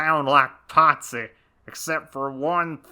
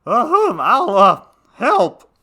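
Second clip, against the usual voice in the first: high cat voice; 5 semitones higher, 4 dB louder than usual. Acted. Cat character voice throughout.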